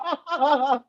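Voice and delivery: high burst of laughter; laughing hard at the joke; real laugh. Real, not acted. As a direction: crack up high. Laughing again.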